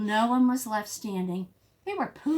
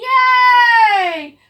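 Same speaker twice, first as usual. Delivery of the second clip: high, loud cheering yay; 14 semitones higher, 15 dB louder than usual. Shown as a demonstration. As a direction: cheer for the rescue. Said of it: "Say it with me".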